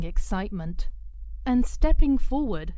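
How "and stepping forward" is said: plain reading voice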